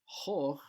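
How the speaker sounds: "hoch" with a rounded o; o vowel. Shown as a demonstration. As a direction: draw out "hoch" slowly on an o vowel. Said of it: "pronounced as hoch".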